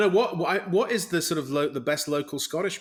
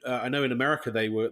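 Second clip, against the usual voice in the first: ordinary delivery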